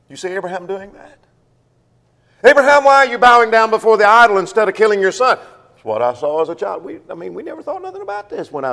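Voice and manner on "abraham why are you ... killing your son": mock challenge. Preacher voices an imagined challenge.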